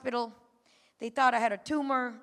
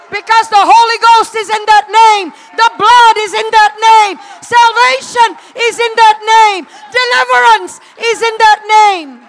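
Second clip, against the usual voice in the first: loud, high, shouting sermon; fiery preacher building to a roar; full-force preaching; 10 semitones higher, 22 dB louder than usual. Acted. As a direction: preach it out to the church.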